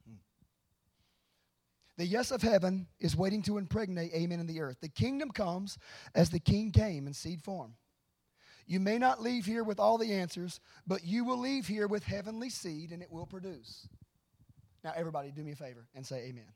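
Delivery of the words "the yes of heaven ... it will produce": loud, emphatic proclamation to a congregation; full-force preaching. Real, not acted. Preacher declaring heaven's promise to the church, forceful preaching.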